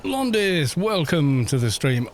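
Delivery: usual speaking voice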